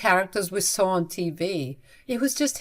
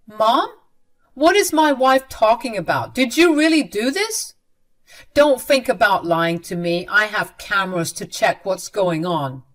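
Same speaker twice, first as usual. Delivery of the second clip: angry confrontation; 2 semitones higher, 6 dB louder than usual. Acted. Son confronts mother over cake.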